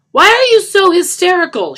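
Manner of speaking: very high, very loud yell; yelling back. Acted. Lisa answering Johnny's outburst, loud high yell. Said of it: "she yelled back".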